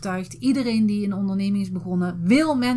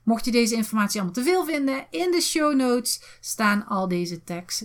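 ordinary talk, nothing marked

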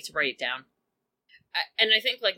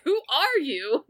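slow, higher, puzzled question; confused Twitchy; 9 semitones higher than usual. Retold or imitated. Twitchy doesn't recognise Tess, slow and puzzled. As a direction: squint at a stranger, drawn out. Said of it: "and he's like".

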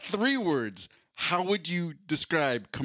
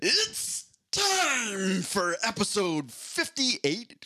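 booming, drawn-out fight-night intro, much higher; ring announcer voice; 8 semitones higher, much slower than usual. Acted. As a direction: stretch every word like a boxing announcer. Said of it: "I'm doing my ring announcer voice".

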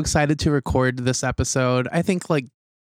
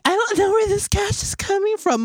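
mock innocent. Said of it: "she kept being like".

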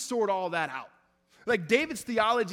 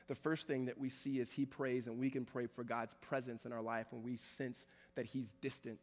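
usual speaking voice

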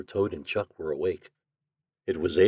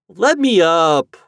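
high, drawn-out loud plea; pleading; 10 semitones higher, 11 dB louder, slower than usual. A narrator's reading. Toad pinned under Chuck on the bed, pleading. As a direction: beg to be let go. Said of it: "pleaded Toad".

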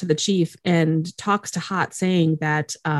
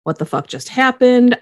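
freaking out; 6 semitones higher, 4 dB louder than usual. Retold or imitated. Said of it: "Charlie is freaking out".

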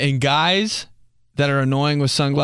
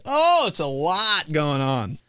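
tipsy; 3 semitones higher, slower than usual. Retold or imitated. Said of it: "before I'm like".

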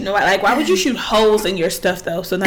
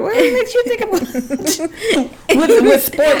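higher laughing outburst; laughing disbelief; 9 semitones higher than usual. Real, not acted. Cohost laughing at the idea of approaching goblins. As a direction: break up laughing mid-sentence.

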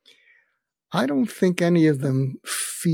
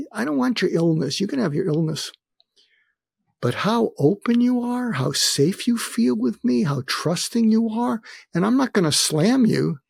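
higher, lightly voiced earnest man; earnest friend voiced; 8 semitones higher than usual. A friend confessing envy of his openness, earnest. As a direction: let the friend's longing come through.

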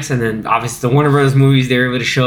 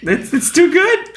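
mock choked up; 12 semitones higher, slower than usual. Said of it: "it hits me every time".

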